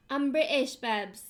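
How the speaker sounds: badly faked British, punching the word British; terrible British accent. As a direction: overdo a bad British accent. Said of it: "That was terrible".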